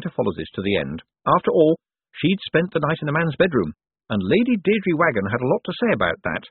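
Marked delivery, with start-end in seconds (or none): none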